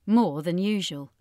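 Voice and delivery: usual speaking voice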